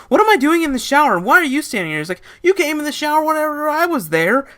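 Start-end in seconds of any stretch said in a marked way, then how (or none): 0.1-2.0 high, loud panicked scream. Retold or imitated. Woman waking in the shower, screaming at the narrator. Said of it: "She screamed". 2.4-4.5 high, loud squeaky retort; mock retort. Narrator snapping back at the woman, high and indignant.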